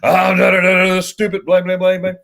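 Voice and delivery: mock angry husband rant. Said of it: "choice words from her husband".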